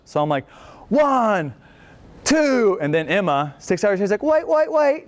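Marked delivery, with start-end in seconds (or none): 0.9-2.8 high, drawn-out counting call. Retold or imitated. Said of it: "I'm doing the count". 4.2-5.0 very high-pitched British imitation; mock British accent. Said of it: "in a nice British accent".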